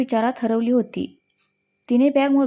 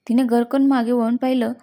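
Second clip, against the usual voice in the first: ordinary delivery, nothing marked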